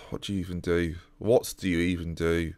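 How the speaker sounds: soft, low, sighing despair; sigh, then ask it quietly, worn down; helpless resignation. Real, not acted. Helpless after another offside highlight.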